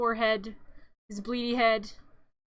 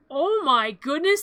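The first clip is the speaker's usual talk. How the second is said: drawn-out, louder mock amazement; mock gushing; 3 semitones higher, 7 dB louder, slower than usual. Boy sees his cute new pet, gushing. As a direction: gush slowly in pretend wonder.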